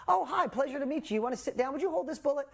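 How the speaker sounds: high, fake-polite welcome with a threat underneath; mock sweet menace. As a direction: greet the boy sweetly while polishing the guns. Dad greets daughter's boyfriend menacingly.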